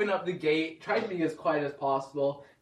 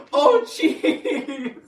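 higher, louder, laughing "oh jeez"; exasperated; 9 semitones higher, 6 dB louder than usual. Retold or imitated. Thinking about the slow old driver, laughing exasperation. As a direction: sigh it out, half laughing.

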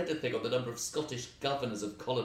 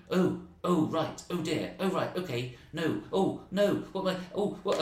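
flustered; 7 semitones higher, 4 dB louder than usual. Retold or imitated. Comic flustered reaction on stage.

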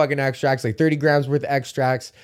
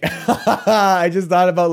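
ordinary talk

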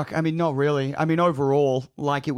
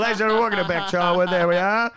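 fast, loud, higher MC intro; announcer voice; 4 semitones higher, 6 dB louder than usual. Pretend. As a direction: introduce her like a host. Mock MC introduction.

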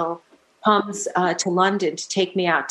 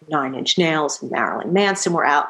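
ordinary talk

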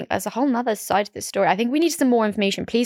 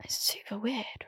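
unvoiced gossipy whisper; 15 dB quieter than usual. Real, not acted. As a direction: hush it like a secret. Gossiping friends drop voices.

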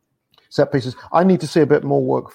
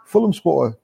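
higher, eager voice of the shop guy; imitating shop assistant; 9 semitones higher, 4 dB louder than usual. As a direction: quote the excited supermarket clerk. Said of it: "the guy says".

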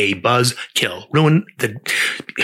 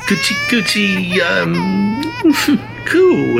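put-on much higher cooing baby talk; 8 semitones higher, much slower than usual. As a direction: coo at the baby.

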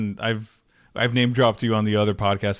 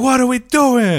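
much higher, louder, very slow coach bark; Vince Lombardi impression; 13 semitones higher, 9 dB louder, slower than usual. Host imitating Vince Lombardi from a clip, booming coach voice. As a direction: bellow it like an old-school football coach. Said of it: "he's like".

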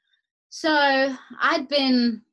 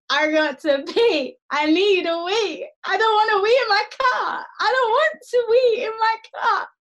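panicked whining; 12 semitones higher, 7 dB louder than usual. Retold or imitated. Panicked need to pee.

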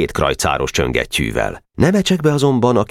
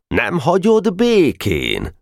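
much higher, slow, warning question; stern teacher; 13 semitones higher, much slower than usual. Acted. Teacher catching Weiss reaching for the putty. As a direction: catch the boy in the act.

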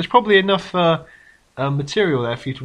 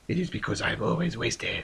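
whisper; 4 semitones lower, 8 dB quieter than usual. Acted. El Nombre confesses to being drunk.

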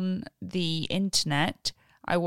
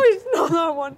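laugh-crying; 7 semitones higher, 6 dB louder than usual. Real, not acted. Mouth burning from hot wing.

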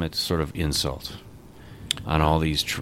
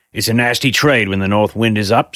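a lightly voiced stern gentleman, a bit raised; stern board member; 4 semitones higher, 8 dB louder than usual. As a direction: grumble about the trade. Limbkins speaks at the board.

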